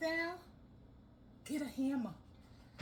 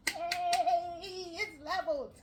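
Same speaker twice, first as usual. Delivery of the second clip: laughing triumph; 5 semitones higher, 5 dB louder, slower than usual. Real, not acted. Pleased it's level.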